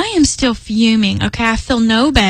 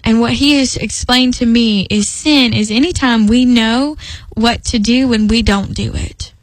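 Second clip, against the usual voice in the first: ordinary talk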